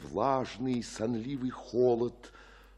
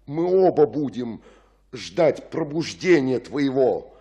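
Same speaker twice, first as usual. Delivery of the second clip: urgent loud; 4 semitones higher, 13 dB louder than usual. Acted. Friar lays out rescue plan.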